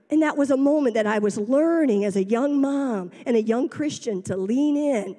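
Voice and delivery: ordinary talk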